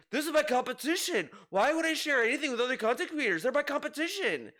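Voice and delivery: high-pitched mock complaint; mocking imitation. Host imitates a streamer refusing to share with rivals, mock-indignant. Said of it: "where he's like".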